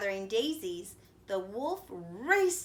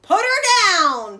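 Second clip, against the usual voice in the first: very loud, higher bellow; 11 semitones higher, 16 dB louder than usual. A narrator's reading. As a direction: bellow the order. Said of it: "bellowed the woodcutter".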